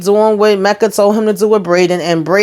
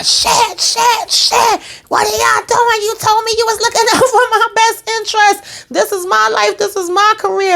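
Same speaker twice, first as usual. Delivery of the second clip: much higher, frantic imitated rant; mock Zeke freaking out; 14 semitones higher than usual. Recapper imitating Zeke blowing up at the people who used him, high and frantic. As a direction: play the panicked young athlete losing it at his handlers. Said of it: "Now Zeke starts going on".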